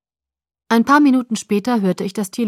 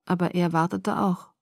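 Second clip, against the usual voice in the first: usual speaking voice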